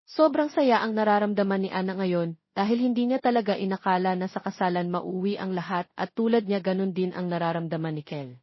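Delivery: plain reading voice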